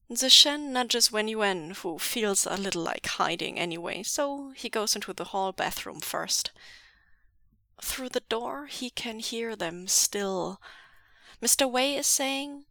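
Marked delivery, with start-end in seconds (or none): none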